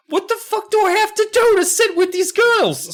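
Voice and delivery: high, loud exasperated whine; shrill frustrated girl. Retold or imitated. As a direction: complain like a frustrated kid. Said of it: "Nessa's like".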